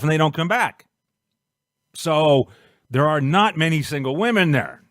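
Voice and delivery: normal talking voice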